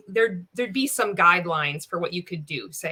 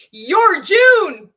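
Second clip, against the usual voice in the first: loud, high shout with laughter in it; laughing, hammed-up cheer; mock hype slogan; 10 semitones higher, 12 dB louder than usual. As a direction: whoop it out like a cheesy catchphrase. Mocking the promo's slogan, laughing.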